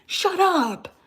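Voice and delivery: exasperated yell at a liberal sister; mock shout. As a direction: explode into a sudden shout. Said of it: "I'm like".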